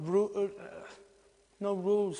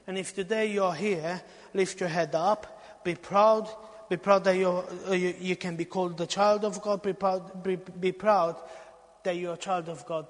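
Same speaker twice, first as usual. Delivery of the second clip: rousing exhortation; 6 dB louder than usual. Acted. Preacher urging listeners to be proud, rising loud. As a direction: push them to lift their heads.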